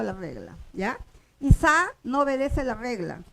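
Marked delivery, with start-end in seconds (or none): 1.4-1.9 "Y Sa" as one word, force on "Sa"; stress on second syllable. Shown as a demonstration. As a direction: lean on the final syllable of "Y Sa". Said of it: "lleva la fuerza de pronunciación".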